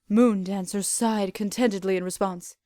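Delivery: normal talking voice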